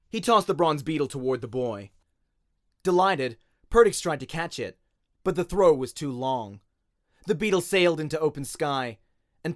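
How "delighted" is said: ordinary delivery, nothing marked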